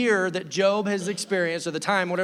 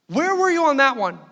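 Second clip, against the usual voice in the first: indignant Job; 8 semitones higher, 8 dB louder than usual. Retold or imitated. Job complaining to God.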